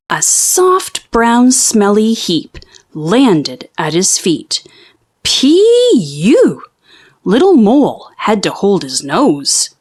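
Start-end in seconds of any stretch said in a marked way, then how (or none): 5.2-6.6 disgusted P-U. A narrator's reading. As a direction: hold your nose and cry it out. Mole smells the heap.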